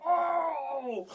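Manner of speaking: loud high cry of pain; shocked yell. Retold or imitated. Boy retelling his arm going numb, loud pained cry. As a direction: yell out as the numbness hits.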